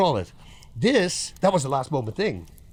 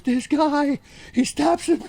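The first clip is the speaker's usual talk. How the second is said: much higher, amazed, imitating another fighter; excited retelling; 13 semitones higher, slower than usual. Fighter tells the story to another fighter, in high, amazed disbelief. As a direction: pitch it way up like a stunned storyteller. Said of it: "And he goes".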